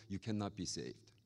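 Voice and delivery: normal talking voice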